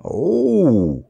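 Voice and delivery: drawn-out character "oh"; awed oh. A narrator's reading. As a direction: let it fall away in wonder. Dummy awed by a princess.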